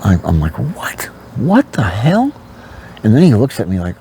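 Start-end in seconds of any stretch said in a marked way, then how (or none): 0.6-2.3 higher, stunned outburst; shocked. Retold or imitated. A teen hearing his girlfriend cheated, shocked and higher. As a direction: caught off guard, blurting it out.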